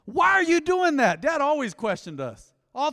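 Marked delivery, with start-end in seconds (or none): 0.1-1.2 high, loud, stern dad demand; dad's stern question. Retold or imitated. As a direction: bark it like a father catching you. Imitating dad questioning his kids.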